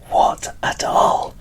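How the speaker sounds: ordinary talk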